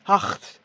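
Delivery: "Hart" with a throaty R from the back of the throat; guttural r. Shown as a demonstration. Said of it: "on dit hart".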